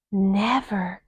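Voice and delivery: breathless frightened whisper; gasped whisper. A narrator's reading. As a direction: gasp the word out. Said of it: "gasped Annie".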